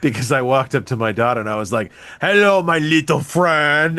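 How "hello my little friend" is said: put-on drawn-out Cuban-accented greeting; Cuban movie accent. Dad greets his daughter with a film line, mock Cuban accent. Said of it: "That's a Cuban accent from a movie".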